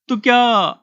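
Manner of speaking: high call stretched into the night; long-carrying call. A narrator's reading. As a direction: call the boy's name across the dark fields. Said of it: "त्याचा पल्लेदार आवाज रात्रीची चिरफाड करीत दूर गेला".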